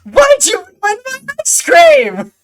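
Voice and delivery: very high, loud, stretched goblin screech; outraged goblin. Acted. Goblin demands an answer about her ice cream, screeching. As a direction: wail the accusation as a furious goblin. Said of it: "the goblin slams her hands on the table".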